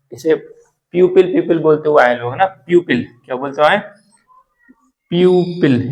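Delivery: usual speaking voice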